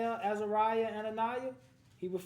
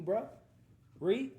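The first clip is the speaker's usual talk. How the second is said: normal talking voice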